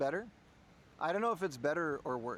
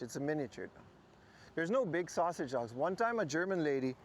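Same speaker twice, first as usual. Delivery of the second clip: ordinary delivery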